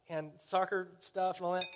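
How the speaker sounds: normal talking voice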